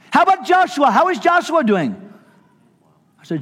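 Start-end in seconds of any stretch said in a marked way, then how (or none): 0.1-2.0 very high, loud, eager phone greeting; excitable Brother Vic. Retold or imitated. Vic calling the pastor years later, overjoyed. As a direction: greet him like a long-lost friend. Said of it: "excitable kind of guy".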